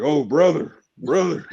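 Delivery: a hint of a hammed-up wrestling promo; playful Macho Man impression; Macho Man Randy Savage. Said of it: "sounds like Macho Man Randy Savage".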